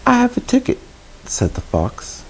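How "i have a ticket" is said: very high-pitched fox voice; high character voice, making an offer; sly fox character. Acted.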